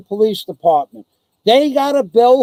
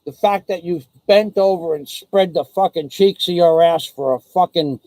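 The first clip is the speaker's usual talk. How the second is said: usual speaking voice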